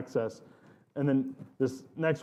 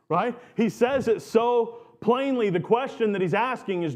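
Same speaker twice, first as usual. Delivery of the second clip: ordinary talk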